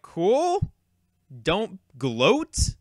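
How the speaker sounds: drawn-out, high-pitched mock "cool"; unimpressed reply. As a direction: retell an awkward, put-out reply to a bragger. Retold reply to the gloating kid.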